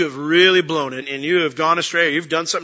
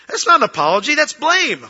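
louder and much higher, punching not and blame; emphatic rebuttal; 11 semitones higher, 6 dB louder than usual. Real, not acted. Preacher calling out a fake apology, loud and high. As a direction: call it out from the pulpit.

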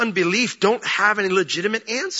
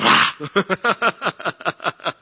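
frustrated groan; 3 semitones higher than usual. Pretend. Frustrated reaction to Bible quote.

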